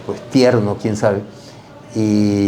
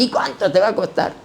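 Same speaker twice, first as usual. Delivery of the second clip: retold dialogue with uncle; 12 semitones higher than usual.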